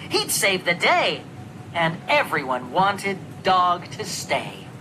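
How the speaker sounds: plain reading voice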